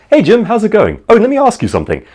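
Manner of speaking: breezy friendly small talk; chirpy casual greeting. Pretend. Casual greeting set against movie line.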